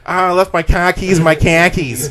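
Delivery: high, loud Boston khakis joke; Boston accent. Pretend. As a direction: drop the r like a Boston guy. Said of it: "thick Boston accent".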